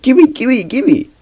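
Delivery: high, eager gimme gimme; greedy kid. Acted. Sick boy grabs for the medicine, high and eager. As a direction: beg like a grabby child.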